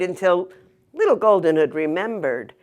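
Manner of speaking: usual speaking voice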